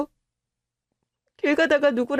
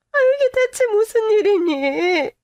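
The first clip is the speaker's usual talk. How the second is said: slow, higher anguished lament; aghast lament; 5 semitones higher, 3 dB louder, slower than usual. Acted. Mother laments her daughter's treatment, slow and anguished. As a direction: drag it out in disbelief.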